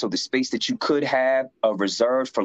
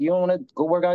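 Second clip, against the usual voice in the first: a lightly voiced curious fellow inmate; curious inmate friend; 9 semitones higher, much faster than usual. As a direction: voice pitched well up, asking eagerly. Friend voiced in a prison story.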